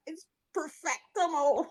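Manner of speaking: laughing through a made-up word; gushing with a laugh. Real, not acted. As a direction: giggle it out, delighted. Gushing over the show's scenery.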